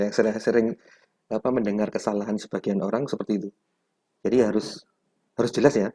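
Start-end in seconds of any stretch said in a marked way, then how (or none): none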